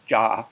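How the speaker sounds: "ja" with an English J; J sound. Shown as a demonstration. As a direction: Oklahoma dialect "ja", opening on J. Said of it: "have that as a J sound".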